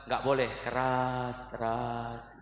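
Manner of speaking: "keras-keras" stretched very slowly on a level low note; low, level, long-held vowels on "keras-keras"; drawn-out punchline. Retold or imitated. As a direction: draw out each half of "keras-keras" like a slow call. Punchline mimicking drawn-out call to prayer.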